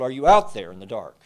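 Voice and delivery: loud call into the dark; yelled call. Retold or imitated. A townsman calls to boats at sea, loud yell. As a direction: shout out over the water. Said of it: "somebody yelled".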